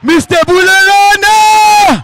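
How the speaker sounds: long, high, drawn-out shout; calling out. Real, not acted. Speaker hypes up the crowd to call the next speaker, shouting. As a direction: announce the name like a boxing ring MC.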